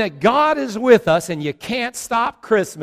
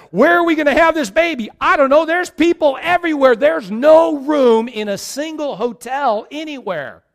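higher, louder, fast panicked pleading; frantic couple; 8 semitones higher, 6 dB louder than usual. Retold or imitated. Joseph and Mary finding no room in any hotel, higher, louder, panicked. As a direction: rush it out like a desperate husband searching for a room. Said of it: "they're going".

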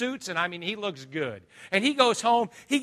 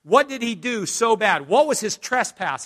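ordinary talk, nothing marked

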